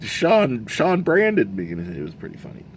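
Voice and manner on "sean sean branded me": high-pitched mock protest; high retold complaint, drawn out at the end; imitated complaint. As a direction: imitate an aggrieved player, pitched way up. Imitates player's complaint about branding.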